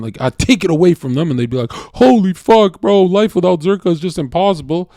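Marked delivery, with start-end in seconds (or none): 1.7-4.9 put-on retelling how a heartbroken girlfriend pleaded; hammed-up wailing girlfriend. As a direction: stretch out the despair like a crying girl. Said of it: "There's one who actually cried live".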